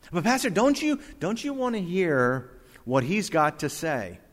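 high, pleading questioner's voice; objecting congregant. Acted. Congregant pressing the pastor to hear him out, high and insistent. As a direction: play an eager objector, pitched well up.

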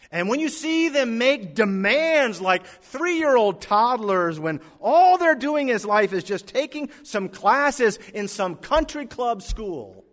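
sermon at full force, mocking spoiled kids; heated preaching.

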